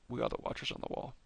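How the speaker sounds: quick dramatic whisper of a famous line; hushed quote. Retold or imitated. Vlogger quotes a show's famous oath to viewers, whispering. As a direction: breathe it out like a solemn vow.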